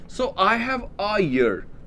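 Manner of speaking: year said very slowly with a y start; slow example sentence. Shown as a demonstration. Teacher to students, slow model sentence. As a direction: spell it out word by word. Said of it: "यहाँ पे ए लगेगा".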